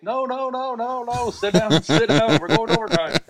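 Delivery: high-pitched mock yell, repeated urgently; retold frantic yelling. Fan at the bleachers stopping people leaving, retold high yelling. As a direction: re-enact calling a departing crowd back. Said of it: "I'm yelling like".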